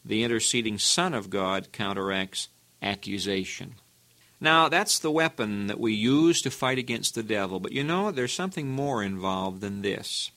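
ordinary talk, nothing marked

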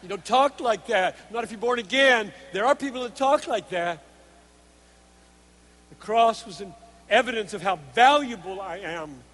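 loud, measured sermon delivery; emphatic preaching. Real, not acted. Preacher laying out God's design in loving us, emphatic preaching. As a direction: drive the point home word by word.

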